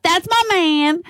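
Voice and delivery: high, drawn-out camp "That's my man"; camp cheer. Retold or imitated. Yelling to the shooter, in a drawn-out camp cheer. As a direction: sing it out like a camp fan at courtside. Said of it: "everyone yells out".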